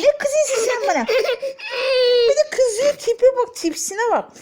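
high, laughing mock complaint; laughing tease. Real, not acted. Baby cross over sock. (kızan bebeğe gülerek, tiz takılma)

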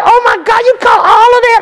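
wife thrilled by the fish haul, high excited gush; amazed wife. Retold or imitated. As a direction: squeal it like an impressed wife.

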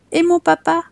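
put-on high, trusting little boy's question; innocent small child. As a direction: ask mama like a trusting little boy. Child Jean asks about father.

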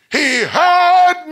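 high, loud, drawn-out cry; exultant preaching shout. Real, not acted. Preacher testifies that God heard him, long high shout. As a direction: stretch it into a full-voice testimony holler.

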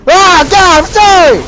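very high, loud pleading; frantic full-voice yelling; panicked scream. Real, not acted. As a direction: shriek an apology under attack. Bear attacks after the serenade.

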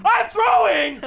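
high, loud gaming yell; frantic shout. Real, not acted. Gamer admitting blunder mid-match, frantic high shout.

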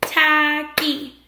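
"Tacky" in two loud separate beats, TACK-Y; clapped into syllables. Shown as a demonstration. Teacher clapping out the word with pupils, clapped syllables. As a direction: break "Tacky" into slow, distinct syllables. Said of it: "Let's clap it".

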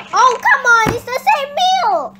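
loud, high-pitched whine of complaint; hammed-up outraged groan; exasperated protest. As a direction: protest loudly, dragging out the last words. Prisoner protests the repeated meal.

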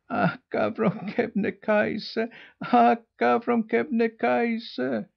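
high, slow, plaintive little gosling voice; plaintive gosling cry. A narrator's reading. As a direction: cry out like a weary young goose. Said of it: "they cried in plaintive tones".

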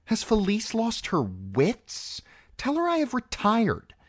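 high, imperious exasperation; an irritated queen issuing orders; snapped. A narrator's reading. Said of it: "snapped Circe".

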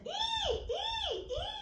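very high, soft squeaky dolphin chirps; dolphin squeaks. Acted. Teacher squeaks as the dolphin jumps away for the kids.

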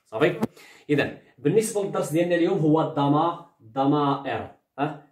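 ordinary delivery

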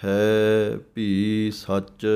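ਹੈ ਭੀ ਸੱਚ very slow, ਹੈ and ਭੀ kept apart; separate words. Shown as a demonstration. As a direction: keep ਹੈ and ਭੀ distinct, never ਹੈਪੀ. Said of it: "ਅਲੱਗ-ਅਲੱਗ ਬੋਲਣਾ ਹੈ". (ਹੈ ਭੀ ਅਲੱਗ-ਅਲੱਗ, ਹੌਲੀ)

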